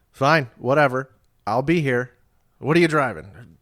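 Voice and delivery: curt dispatcher, retold. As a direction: play the bored cop on the line.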